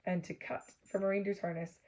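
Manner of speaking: usual speaking voice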